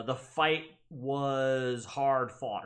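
slow example. Shown as a demonstration. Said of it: "if fight were the subject".

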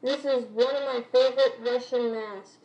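muffled behind mask filter; through a gas mask. Real, not acted. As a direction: voice through respirator. Seen 1: Full-face gas mask with filter worn throughout the labelled words.

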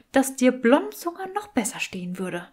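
teasing skull whispers the compliment; sly whisper. Acted. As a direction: breathe it out as a sly secret. Skull teases Draco, whispering. (verschmitzt geflüstert)

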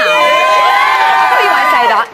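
high, drawn-out shout to the crowd; big slow showy holler; rousing host shout. Real, not acted. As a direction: stretch it out loudly over the cheering. Host answering cheering studio audience.

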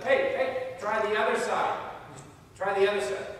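yelling. Acted. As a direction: call out to a boat across the water. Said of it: "Someone yells at them".

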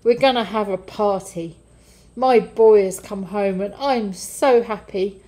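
happy father shouting orders to the servants; joyful shout. Acted. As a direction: ring it out with delight. Father calls joyfully for party.

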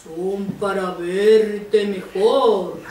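low, very slow wolf voice; big bad wolf, lingering on each word; disguised wolf. Wolf answers as false grandmother.

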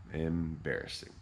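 usual speaking voice

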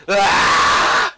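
sharp unvoiced yelp of fright; startled scream. Real, not acted. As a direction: cry out as it drops. Sudden drop on ride.

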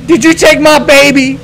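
loud, high demand; alarmed, furious parent shout; accusing yell. Pretend. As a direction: yell it at the kidnapper. Confronting a figure over missing baby.

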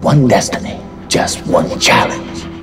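low, slow trailer announcer; movie trailer voice. Shown as a demonstration. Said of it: "com aquela voz de, de trailer".

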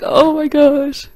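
overwhelmed by the moment; stunned delighted exclamation; starstruck awe. Retold or imitated. Overwhelmed fan reaction.

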